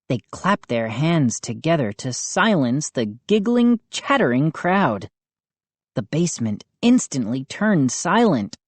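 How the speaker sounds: usual speaking voice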